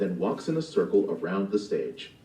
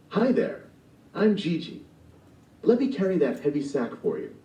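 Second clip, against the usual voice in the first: lightly voiced eager young man, higher; a cheerful, helpful boy offering aid; Gigi voice; 7 semitones higher than usual. Gigi offering to carry the old woman's sack, eager. Gigi's line read in play.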